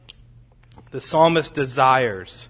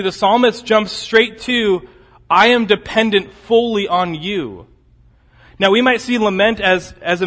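higher, louder, driving sermon voice; full-force preaching; 6 semitones higher, 6 dB louder than usual. Acted. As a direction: preach it out with rising fire.

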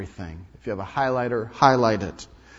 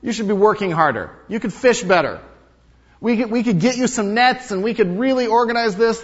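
higher, louder eager salesman; businessman pitch; 9 semitones higher, 6 dB louder than usual. Retold or imitated. Businessman urging the fisherman to work harder, eager. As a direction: sell him the big plan. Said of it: "He says".

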